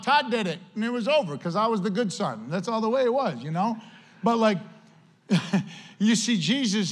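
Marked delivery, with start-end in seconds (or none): none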